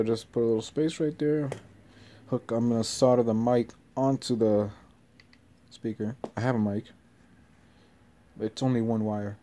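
normal talking voice

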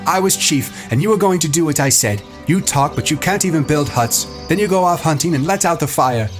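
high-pitched indignant tirade; angry scolding. A narrator's reading. Ralph berating Jack as chief, angry. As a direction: lay into him, pitched high.

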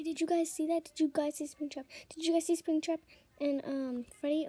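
loud excited repeated question; real loud excitement; excited shout. Real, not acted. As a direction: tell the viewers breathlessly. Excited after scare.

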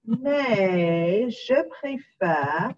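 mais je préfère drawn out very slowly; slow dictation. Shown as a demonstration. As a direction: stretch mais je préfère word by word. Teacher dictates slowly while typing.

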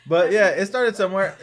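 words spilling out high and loud through laughter; laughing while talking. Real, not acted. Guest resumes his story while still laughing. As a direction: keep laughing as you get back on track.

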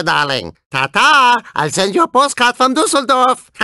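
put-on thick comic German accent, smug racer taunts; hammed-up German accent, playful and boastful; German cyborg accent. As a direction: gloat like a German robot race car passing rivals.

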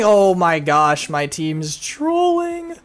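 slow, high wail of frustration; exasperated cry. Real, not acted. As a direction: draw it out in despair. Teammate trolling.

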